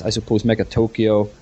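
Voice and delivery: ordinary delivery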